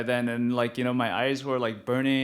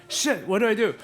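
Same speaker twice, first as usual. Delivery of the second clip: mock panic; 7 semitones higher, 3 dB louder than usual. Panicking about being high.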